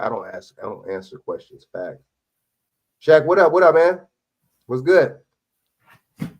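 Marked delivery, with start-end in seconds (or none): 0.0-2.0 low, soft imitation of a firm refusal; understated, low-voiced stonewalling; quoting the driver's refusal. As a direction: echo his refusal quietly and low. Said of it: "I like how he said that".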